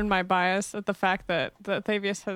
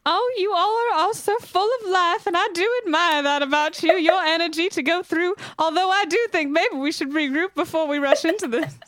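high, lilting, louder character voice; genteel lady character; 8 semitones higher, 4 dB louder than usual. Acted. Lady in hoop skirt urges companions to regroup, high gracious character voice. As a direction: play a gracious lady, pitched well up.

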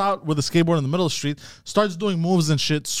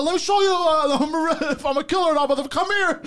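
mock threatening yell; 12 semitones higher than usual. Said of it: "I go".

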